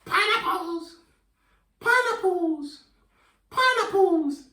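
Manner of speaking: very high, slow and stretched; high silly fruit voice for kids; squeaky high pineapple voice. Shown as a demonstration. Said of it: "pretend to be a pineapple".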